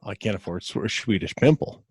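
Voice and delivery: low, soft Yooper mumble; poor Yooper accent. Pretend. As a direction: answer quietly in a shaky attempt at Yooper. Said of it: "I can't do one worth a shit".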